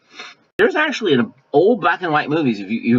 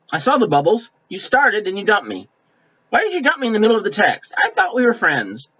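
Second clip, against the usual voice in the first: higher, hammed-up wounded friend; mock hurt indignation; 6 semitones higher than usual. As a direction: play it as a pouty accusation, pitched up. Mocking a texter who feels dumped.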